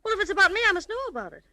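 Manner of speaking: alarmed demand. Acted.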